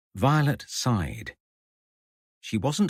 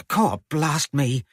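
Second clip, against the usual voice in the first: slow, loud, high Norfolk exclamation; broad Norfolk outburst; serving girl's strong Norfolk cry; strong Norfolk accent; 6 semitones higher, 4 dB louder, slower than usual. A narrator's reading. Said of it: "a stronger version of her usual Norfolk accent".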